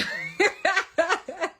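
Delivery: high loud burst of laughter. Real, not acted. Laughing at her own joke about eating men. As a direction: crack up loud.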